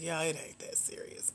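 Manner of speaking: whispered aside, rolling her eyes. Retold or imitated. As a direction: whisper it like a tired dismissal. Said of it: "I just sit and roll my eyes most of the time like".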